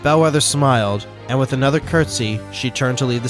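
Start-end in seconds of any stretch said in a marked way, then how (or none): none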